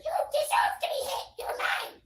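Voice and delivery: high, harsh imitated screech; hammed-up screaming fit; mock raging abuser. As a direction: screech it loud like an attacker. Retold attacker yelling while hitting.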